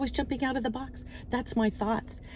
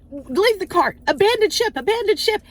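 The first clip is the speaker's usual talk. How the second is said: higher, louder rising alarm call; mock panic shout; 10 semitones higher, 7 dB louder than usual. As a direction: shout like the ship is sinking. Shoppers fleeing from Stacy.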